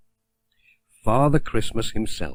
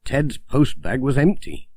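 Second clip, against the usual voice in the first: ordinary delivery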